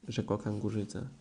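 ordinary delivery, nothing marked

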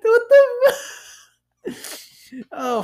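shrieking high, drawn-out cry; startled cry. Real, not acted. Catching his own slip on the podcast, shrieking high. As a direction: caught off guard, voice jumps way up.

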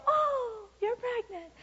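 very high, cooing delight; hammed-up squeal of discovery; gushing surprise. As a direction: imitate people fussing over an expectant mother. Imitates people noticing a pregnancy.

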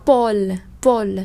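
Paul with the final L clearly sounded. Shown as a demonstration. Teacher points out the final L in Paul, clear L. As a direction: say Paul and let the L ring. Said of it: "Hai âm cuối các bạn để ý nè".

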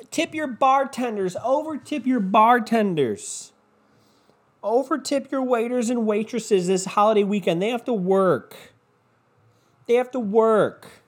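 high, preachy imitation of online posts; mock a pleading post, pitched high; mocking plea. Podcaster reading out holiday tipping posts, mocking plea.

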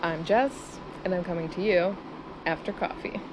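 usual speaking voice

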